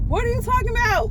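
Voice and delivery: high, fast, near-crying disbelief; shocked disbelief. Retold or imitated. Telling the tow truck guy her spare has no rim, high stunned disbelief. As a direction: squeak it out like you can't believe it.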